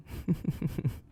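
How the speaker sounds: high cruel giggle; high wicked giggle. Acted. Amused at captive.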